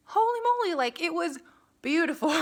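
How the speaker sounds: high, loud burst of amazement; awestruck gushing. Real, not acted. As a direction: gush over a stunning view. Gushes about Sedona's landscape.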